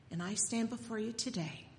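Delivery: normal talking voice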